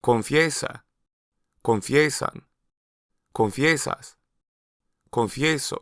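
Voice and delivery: "Confiesa" said con-FIE-sa, with a distinct y glide in the stressed syllable; clear ie sound. Shown as a demonstration. Teacher to learners, highlighting the y sound. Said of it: "the stressed variation of the root has a distinct Y sound".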